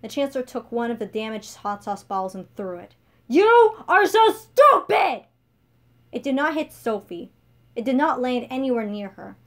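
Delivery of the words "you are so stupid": a much higher, much louder and slowed shout; furious yell. Acted. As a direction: hurl the insult at her. Chancellor yells at Sophie.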